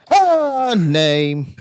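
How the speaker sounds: slow, loud drawn-out shout. Pretend. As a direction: bellow each word slowly. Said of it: "Notice how I shouted".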